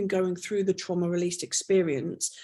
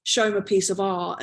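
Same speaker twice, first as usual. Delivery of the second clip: usual speaking voice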